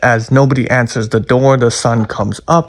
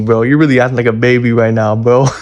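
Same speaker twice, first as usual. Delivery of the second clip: ordinary talk, nothing marked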